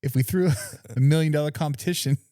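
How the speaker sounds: put-on high, laughing, botched Aussie accent; bad Australian accent. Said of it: "That was terrible".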